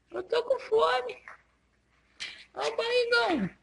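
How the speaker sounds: very high squeaky voice for the dog; voicing the dog. Mom speaks for the dog as it eats, high and squeaky. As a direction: squeak it out as the happy eating dog.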